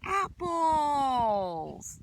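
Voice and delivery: loud, high cry of Apples; excited shout. A narrator's reading. Librarian revealing the last food to kids, loud and delighted.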